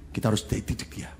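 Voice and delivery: whispered firm resolve. Retold or imitated. Husband whispering to his wife they must discipline her. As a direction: breathe it to your wife.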